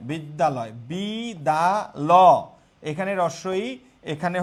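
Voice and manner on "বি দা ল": বি, দা, ল as separate beats with pauses; syllable by syllable. Shown as a demonstration. Teacher counting vowels in a word, বি দা ল said piece by piece. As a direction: isolate each syllable: বি... দা... ল.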